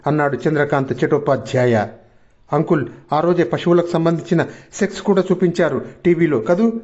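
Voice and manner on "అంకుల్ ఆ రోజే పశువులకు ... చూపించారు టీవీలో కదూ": plain reading voice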